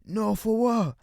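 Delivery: high-pitched stoned mock voice; J R Smith high voice. Narrator mocking J R Smith, high-pitched stoned voice. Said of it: "that's my J R Smith high voice".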